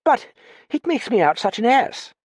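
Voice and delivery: high-pitched, embarrassed whine; uncomfortable complaint. A narrator's reading. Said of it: "the other complained uncomfortably".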